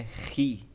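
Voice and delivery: "Ri" with a throaty Spanish j; Spanish-j sound. Shown as a demonstration. Teacher naming a Greek letter, "Ri" with a Spanish-j sound. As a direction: start "Ri" like the j in Spanish mujer. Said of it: "como se fosse no espanhol a palavra mujer".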